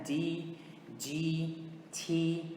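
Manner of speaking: D, G, T said apart with long "ee"; similar letter names. Shown as a demonstration. Teacher showing letters that sound alike, D, G, T with "ee". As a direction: say D, G, T as dee, jee, tee. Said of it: "a mesma pronúncia".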